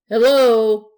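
big, booming hello, drawn out; hearty greeting. A narrator's reading. Man opens the door to expected guests, hearty greeting.

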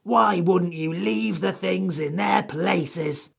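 cross creature drawing out its complaint; furious creature. A narrator's reading. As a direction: scold them as an aggrieved high-voiced creature. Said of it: "The Samiad was furious".

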